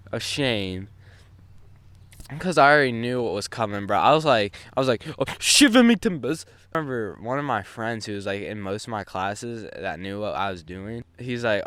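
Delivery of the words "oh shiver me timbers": much higher, louder pirate cry; mock pirate exclamation. Kid facing trouble blurts out a mock pirate cry. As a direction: ham it up like a cartoon pirate.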